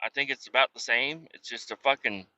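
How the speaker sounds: usual speaking voice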